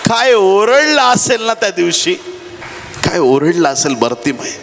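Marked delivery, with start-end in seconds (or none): 0.1-2.1 high-pitched preacher's exclamation; impassioned, raised-pitch wonder; fervent exclamation. Real, not acted. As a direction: marvel aloud, voice pitched well up. Marvels, then repeats it quietly.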